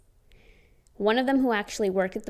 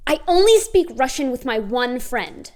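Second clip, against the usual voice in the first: a louder, huffy mock retort; imitating her angry retort; 3 semitones higher, 8 dB louder than usual. The coworker storming off from the Russian speaker, mock angry. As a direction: snap it back like she's storming out. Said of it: "angrily walking out".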